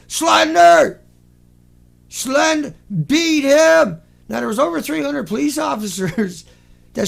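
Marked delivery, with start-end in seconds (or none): 0.1-4.0 loud, high commander's shout; outraged commander. Acted. Commander responding to the professor's warning, mock outrage. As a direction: shout it like a commander giving orders. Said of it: "Commander responded".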